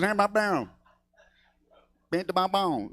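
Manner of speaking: twangy rubber-band voice, imitated; mock Texan, words bouncing like a rubber band; Ross Perot. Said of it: "he sounds like a rubber band".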